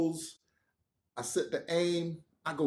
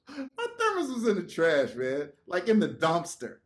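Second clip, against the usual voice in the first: ordinary delivery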